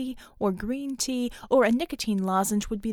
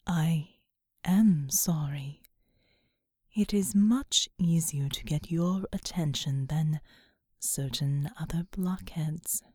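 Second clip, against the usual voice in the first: low breathy whisper of a vast angel; whispering angelic being; 7 semitones lower than usual. Acted. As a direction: whisper low and otherworldly, slowly. Seraphim speaks.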